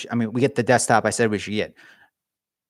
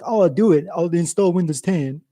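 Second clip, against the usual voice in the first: teasing the brother who installs Windows, goofy put-on voice; mock brother voice; 4 semitones higher than usual. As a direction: do a silly slowed-down version of your brother. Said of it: "'Cause my brother talks like this, except he doesn't".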